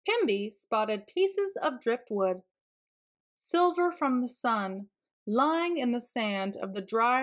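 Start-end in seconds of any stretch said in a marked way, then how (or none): none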